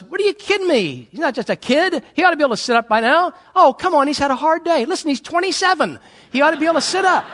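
hammed-up exasperated dad; mock parental quarrel. Preacher acting the father arguing with the mother, exasperated.

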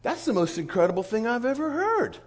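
high-pitched, wowed exclamation; amazed listener. Retold or imitated. The guy reacting to the Kingdom Circles, amazed and eager. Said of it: "And the guy goes".

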